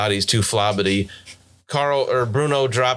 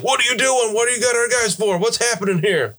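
high-pitched shouted questions, volume held back; mock shouting; 12 semitones higher than usual. Game master voices pursuers chasing the party, high mock shouting. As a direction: shout it like pursuers demanding answers. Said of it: "they're shouting, too, like".

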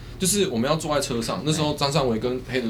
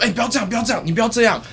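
high, loud calming plea; host holding him back; 10 semitones higher, 4 dB louder, faster than usual. Retold or imitated. Host grabbing the angry client, pleading loudly to stop. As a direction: restrain a furious man with raised pleas. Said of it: "黑人就抓着我说".

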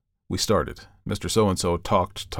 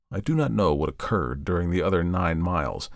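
plain reading voice

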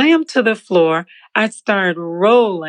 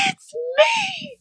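crying shout; 5 dB quieter than usual. Retold or imitated. Running to the pulpit, hands up.

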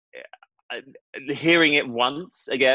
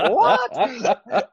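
shocked; 13 semitones higher than usual. Retold or imitated. Retold shocked reaction.

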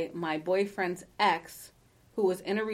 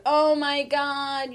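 drawn-out, higher "everybody" voice; mock shock; 7 semitones higher, 4 dB louder, much slower than usual. Mimicking everybody siding with the victim, mock shock. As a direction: imitate scandalized onlookers, slow and high.

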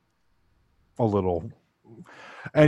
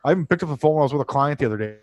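usual speaking voice

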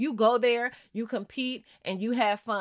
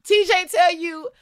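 rousing call; 7 semitones higher, 6 dB louder than usual. Acted. Host cheering eliminated player back into the game, rousing call.

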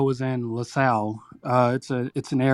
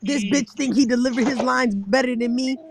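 haughty rival voice; 10 semitones higher than usual. Acted. Acted battle rap taunt.

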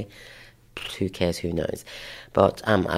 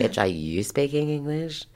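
higher-pitched, incredulous comeback; sassy retort; 6 semitones higher than usual. Retold or imitated. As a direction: throw the question back with mock outrage. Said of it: "I'm like".

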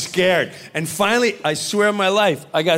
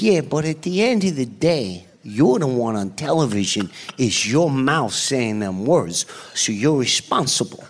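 Joe Pesci impression; 6 semitones lower than usual. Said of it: "That's an incredible impression".

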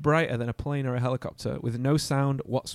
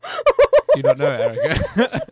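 laughing shout; 13 semitones higher, 9 dB louder, slower than usual. Real, not acted. Teasing friend about her town.

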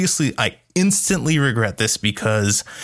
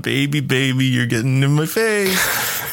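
slow, higher teasing voice to the doll; higher, drawn-out mock scolding of a toy; playful doll-scolding voice; 4 semitones higher than usual. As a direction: tease the doll like a child. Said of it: "I was kinda playing along".

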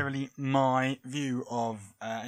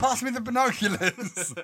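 boxing fan voice; 9 semitones higher, 4 dB louder than usual. Pretend. Continuing fan in cheap seats.

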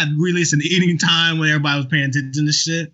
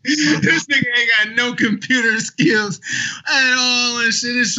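mock crying; 8 semitones higher, slower than usual. Mocking friend's poor tech skills.

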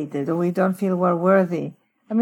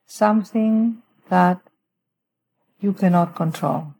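guided meditation. Shown as a demonstration. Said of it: "let me just walk through a little prayer with our listeners".

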